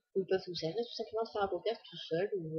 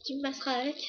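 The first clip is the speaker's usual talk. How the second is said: put-on teasing boyfriend; 6 semitones higher, faster than usual. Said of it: "Il me fait".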